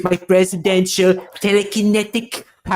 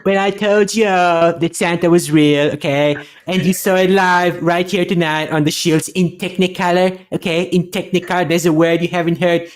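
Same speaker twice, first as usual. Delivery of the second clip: Trump impression. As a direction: brag like Trump at a rally. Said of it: "And now Trump's gonna climb in Santa's sleigh".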